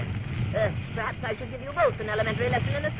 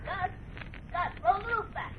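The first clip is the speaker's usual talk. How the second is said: calling out; 7 semitones higher, 5 dB quieter than usual. Acted. Calling out to his assistant.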